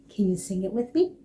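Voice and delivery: ordinary delivery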